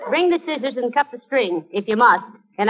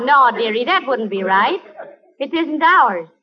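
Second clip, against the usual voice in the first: normal talking voice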